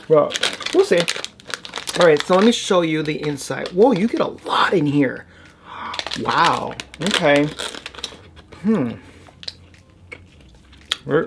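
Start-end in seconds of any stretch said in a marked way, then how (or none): none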